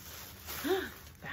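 soft gasp of surprise; delighted gasp. Real, not acted. Collector finding a little dove, soft delighted gasp. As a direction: gasp softly at a find.

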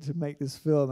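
usual speaking voice

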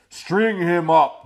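slow, loud demanding cry; furious townsmen; angry mob shout. Acted. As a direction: bark it like a mob. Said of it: "the men of the town shouted".

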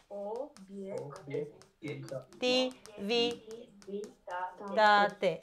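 Obiectivitate drawn out very slowly and softly in syllables; syllable split. Shown as a demonstration. Teacher quizzing pupils, very slow soft split.

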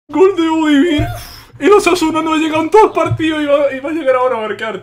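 high, overwhelmed with joy; nearly crying from excitement; emotional, high-pitched goal rant; tearful with emotion. Real, not acted. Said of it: "está llorando de la emoción".

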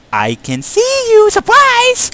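high, loud ghostly shriek; haunted house actor. Pretend. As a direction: pop out like a shrieking phantom. Ghost jump scare on friend.

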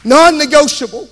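building to a high pulpit shout; fervent, ringing declaration; full-force preaching. Real, not acted. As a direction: proclaim it loudly to the rafters.

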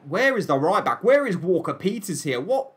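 baffled questions swooping up in pitch; exasperated disbelief. Real, not acted. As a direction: demand answers from your absent defender. Berates own defence after conceding.

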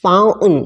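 "fountain" said as "fow-un", T dropped; American "fountain" with no T sound; silent T. Shown as a demonstration. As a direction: drop the T in "fountain", American style. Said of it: "ก็จะเป็น fountain".

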